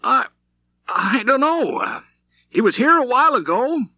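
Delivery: high, loud, panicky bartender voice; a nervous barman cornered by a gunman; frightened stammer. A narrator's reading. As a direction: blurt it out scared, voice jumping way up. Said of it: "Will fumbled with the cloth".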